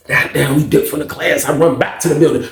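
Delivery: ordinary talk, nothing marked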